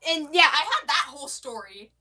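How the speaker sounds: usual speaking voice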